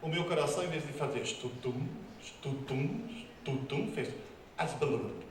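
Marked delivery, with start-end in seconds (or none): 1.2-3.9 heartbeat imitation. 4.6-5.2 garbled heart noise. Retold or imitated. Imitating heart fibrillating.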